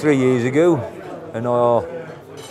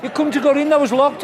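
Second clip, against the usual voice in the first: higher-pitched mock landlord; angry landlord; 11 semitones higher than usual. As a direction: play the suspicious landlord. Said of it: "And he went".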